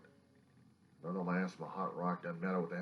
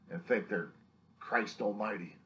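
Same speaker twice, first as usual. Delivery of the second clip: ordinary talk, nothing marked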